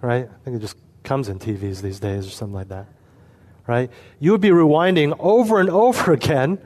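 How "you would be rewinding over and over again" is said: can't hold back the laugh. Real, not acted. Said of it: "I'll try to say this without laughing".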